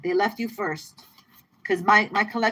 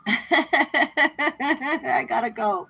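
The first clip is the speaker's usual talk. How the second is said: laughing; 6 semitones higher, 3 dB louder, much slower than usual. Real, not acted. Laughing at her own line.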